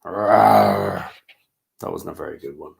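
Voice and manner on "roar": put-on a big lion roar for the kids; demo roar. Shown as a demonstration. Reader shows children a lion roar. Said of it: "Give me your best roar".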